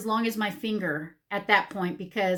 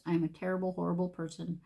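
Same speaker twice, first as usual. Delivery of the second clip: normal talking voice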